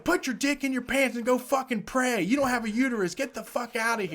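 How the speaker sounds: high-pitched mock yell; retold angry screaming. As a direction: imitate a woman telling someone off. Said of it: "she starts screaming at the guy".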